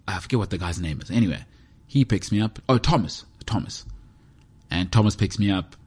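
ordinary delivery

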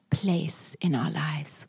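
slow, breathy whisper. Acted. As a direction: let the words fall to a whisper. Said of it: "whispered in prayer".

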